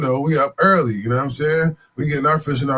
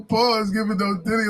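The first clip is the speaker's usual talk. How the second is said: ordinary talk, nothing marked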